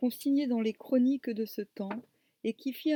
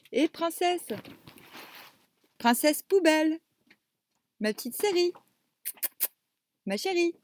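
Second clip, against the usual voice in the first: cooing at a pet; 7 semitones higher, 4 dB louder than usual. Real, not acted. Cooing at her cat.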